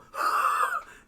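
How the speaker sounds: loud, very high burst of air, as if punched; sudden explosive mock gasp; forced-out breath. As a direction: mimic the wind knocked out of you. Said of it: "you just go".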